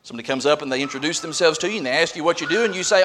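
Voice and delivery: normal talking voice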